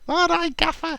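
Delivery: impression of Kev. Player greeting his manager, high put-on voice. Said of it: "He'd be like".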